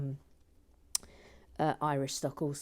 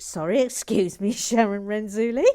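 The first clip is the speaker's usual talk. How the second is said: louder, laughing apology; 3 semitones higher, 9 dB louder than usual. Real, not acted. Host laughing at Sharon's stoned confession. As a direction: break up laughing while apologising.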